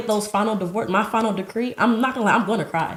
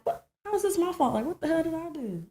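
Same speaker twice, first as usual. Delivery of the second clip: shocked disbelief; 11 semitones higher, 5 dB quieter than usual. Retold or imitated. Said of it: "I'm like".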